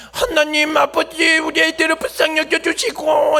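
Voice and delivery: high, slow, lips-pushed-out praying; pouting prayer voice. Retold or imitated. Pastor mimics the teacher praying for her pupils with pouted lips. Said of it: "입술을 삐쭉 내밀고 하시는 그런 포즈".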